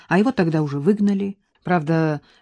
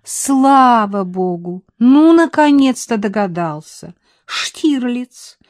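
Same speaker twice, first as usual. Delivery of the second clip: louder, higher, merry woman's voice; cheerful exclaim; 5 semitones higher, 7 dB louder, slower than usual. A narrator's reading. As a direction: tease him gleefully. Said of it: "развеселилась она". (весело и громко, с восторгом)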